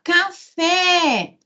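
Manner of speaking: "café" stretched on the stressed last syllable; calling the word. Shown as a demonstration. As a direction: call out "café", holding the final FÉ. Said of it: "Se eu falar".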